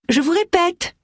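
high-pitched exasperated cry, no extra volume. A narrator's reading. Widow chasing the borrower into the grocery, crying out high. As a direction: raise the pitch sharply, insisting. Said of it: "cria celle-ci".

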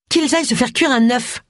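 high, indignant exclamation; high-pitched defiant outburst; exclaiming. A narrator's reading. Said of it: "s'exclama Tony".

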